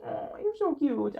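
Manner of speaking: high, slow cooing pet voice; talking to cat. Real, not acted. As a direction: coo at the cat on the bed. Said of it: "I'm talking to my cat".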